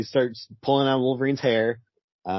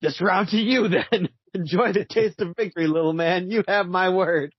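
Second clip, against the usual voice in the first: high, slow gloating villain voice; hammed-up villain; 6 semitones higher, slower than usual. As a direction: gloat like a cartoon villain who lost a round. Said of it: "keep it going for the next two panels".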